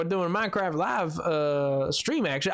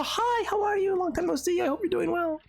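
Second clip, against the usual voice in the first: high-pitched, gushing hello; effusive greeting; 10 semitones higher than usual. Real, not acted. Streamer catches up with a long-absent viewer, effusive high greeting. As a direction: greet a friend after ages apart.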